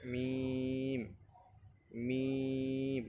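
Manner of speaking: মিম with a long, level ee; recitation demo. Shown as a demonstration. Teacher models the letter for learners, drawn-out recitation. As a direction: hold each মিম on one steady note. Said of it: "আমার সাথে সাথে পড়ার চেষ্টা করেন".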